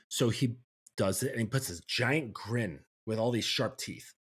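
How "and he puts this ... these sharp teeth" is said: usual speaking voice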